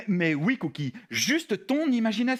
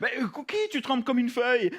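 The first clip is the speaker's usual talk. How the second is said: ordinary talk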